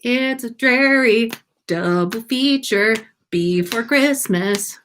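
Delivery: ordinary delivery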